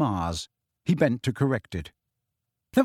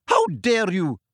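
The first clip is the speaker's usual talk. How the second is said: angry shout; 5 semitones higher, slower than usual. A narrator's reading. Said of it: "the figure shouted as she ran".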